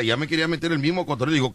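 ordinary talk, nothing marked

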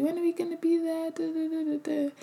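put-on high, quick whiny kid voice; kids nagging. Mother imitating the kids whining from the back seat, high and quick. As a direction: mimic the impatient kids asking when they'll arrive.

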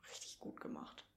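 very low, soft, hushed verdict; hushed praise. Retold or imitated. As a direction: imitate a dentist's whispered praise. Dentist's verdict acted out. (geflüstertes Lob, nachgespielt)

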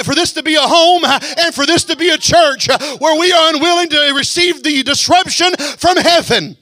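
high, loud preacher's cry; preaching shout. Acted. Preacher declaring to the church, loud cry. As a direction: call it out over the church.

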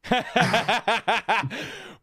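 real burst of loud, high laughter; long run of high chuckles at a friend's jab; hearty laugh. Real, not acted. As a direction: crack up out loud. Laughing at co-host's quip.

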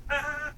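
high-pitched mock cry of fright; imitated scream. Husband imitating his startled wife, high mock scream. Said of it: "And she was like".

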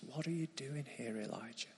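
very soft, near-whispered question; God whispering gently to weary Elijah; still small voice. Acted. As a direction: let God's words barely reach the ear. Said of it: "God whispers to him in a still small voice".

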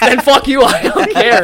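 loud, high, half-laughing defiance; playful outburst. Real, not acted. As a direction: snap back loudly, amused underneath. Argues back over the accent, laughing.

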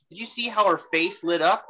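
ordinary delivery

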